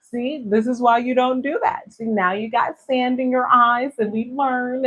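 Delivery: lightly mocking teacher voice; teacher's told-you-so. Voicing teachers lecturing the child.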